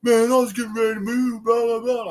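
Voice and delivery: high, whiny put-on voice of a flustered boater; hammed-up flustered stranger; mock freaking-out guy. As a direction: mimic him panicking and complaining. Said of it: "he started freaking out".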